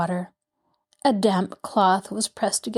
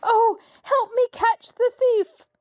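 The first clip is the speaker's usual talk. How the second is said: frantic cry; 14 semitones higher than usual. A narrator's reading. Mrs Willoughby to the guests, frantic cry. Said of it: "she screamed".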